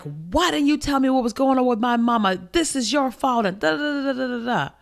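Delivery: mocking, high-pitched blaming voice; going off. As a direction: imitate his accusing tirade, trailing into da-da-da. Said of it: "he's going off on me".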